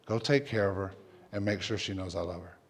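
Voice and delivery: low, hushed retold instruction; tender. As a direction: speak softly, as the Lord prompting. Retells God's quiet instruction.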